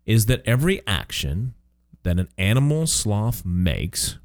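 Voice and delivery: ordinary delivery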